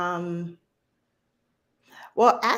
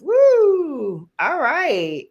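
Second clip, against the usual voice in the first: high, loud woo; cheer; 12 semitones higher, 7 dB louder, much slower than usual. Real, not acted. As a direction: cheer him on. Cheering for Terry.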